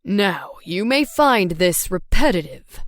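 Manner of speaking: a knowing fatherly aside; dad voice. A narrator's reading.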